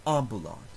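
"ambulat" with AM stressed; stress on first syllable. Shown as a demonstration. Teacher demonstrating the stress rule, first-syllable stress. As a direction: hit the AM of "ambulat", short light middle. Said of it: "moves back to the third to last syllable".

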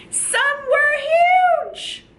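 put-on big high shout for children to copy; really loud. Shown as a demonstration. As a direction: belt it out as if huge. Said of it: "we're gonna be really loud".

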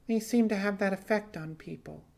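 a hint of wistful musing. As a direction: muse half to herself. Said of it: "she mused".

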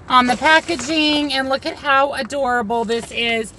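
ordinary talk, nothing marked

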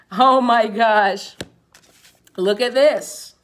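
high, loud, drawn-out exclamation; surprised. Real, not acted. Reader amazed at the card she drew. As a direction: gasp it out amazed.

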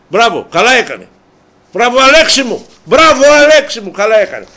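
loud, high-pitched cheer of approval; emphatic bravo. Real, not acted. Host applauding club boss Alexis for what he did, emphatic praise. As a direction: cheer him on, building on the repeat.

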